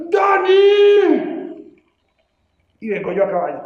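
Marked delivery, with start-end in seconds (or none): 0.1-1.6 high, loud war cry; battle shout. Acted. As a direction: yell the name across the battlefield. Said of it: "pega un grito y dice". (grito de guerra agudo y fuerte)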